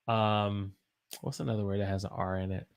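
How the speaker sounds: normal talking voice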